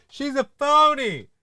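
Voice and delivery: nasal-free high mock taunt; mock whiny accuser. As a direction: sneer it like a whiny heckler. Mocking critics of Harris.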